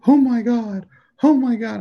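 high, gasping oh my God; mock gasping news anchor. Mocking US news media over the Queen, high gasping. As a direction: ham up breathless TV news shock. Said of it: "They're like".

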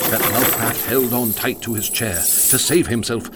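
ordinary delivery

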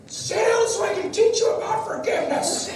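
hammed-up high yell at the kids, laughing through it; mock yelling. Young father yelling at his children during home meeting, mock angry. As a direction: play the frazzled young dad barking at his kids. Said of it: "me yelling at the children".

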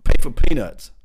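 rising into a loud mock cave-in; hammed-up player caving in. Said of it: "the man can't shoot himself in the foot and say".

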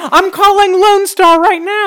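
high, loud, slow threat; angry threat. Acted. Shopkeeper threatening Dak with the police. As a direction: threaten to call the cops.

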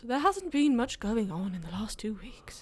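whispered sarcastic jab; breathy sarcastic aside; mock whisper. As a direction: mutter it under your breath as a dig. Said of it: "Sounds like you're having a go".

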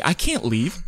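ordinary talk, nothing marked

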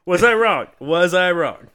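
loud playful gloat; triumphant tease. Real, not acted. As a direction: crow it like you won. Playful vindication.

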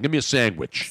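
sandwich as "sangwitch"; Philly pronunciation. Shown as a demonstration. Co-host demonstrates the Philly thing, sandwich as "sangwitch". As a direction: order it with "sang-witch" for sandwich. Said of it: "that's a Philly thing".

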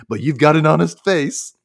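a touch of cartoon character, projected; Ren. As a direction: raise pitch and volume for a light cartoon voice. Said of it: "Ren says".

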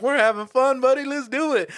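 high, excited daredevil buddy; retold gleeful egging-on. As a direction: egg a nervous passenger on. Acts gleeful reckless roommate.

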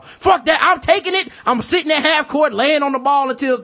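loud high-pitched mock court argument; mock hothead. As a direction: shout it like a stubborn pickup player. Pickup basketball argument acted out.

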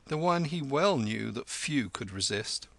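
usual speaking voice

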